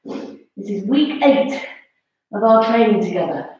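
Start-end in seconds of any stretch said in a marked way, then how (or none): none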